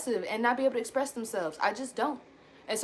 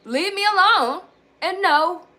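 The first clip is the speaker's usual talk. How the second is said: louder, higher, firm no; loud, pitched-up setting of boundaries; firm toddler refusal; 8 semitones higher, 7 dB louder, slower than usual. Retold or imitated. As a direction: bark it out like a stubborn toddler. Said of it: "setting boundaries at age one".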